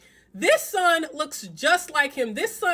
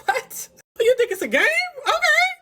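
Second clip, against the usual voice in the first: high, laughing mock outrage; mock disbelief; 14 semitones higher, 5 dB louder than usual. To the man who called her immature, mocking disbelief. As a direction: scoff at him like it's a joke, high and loud.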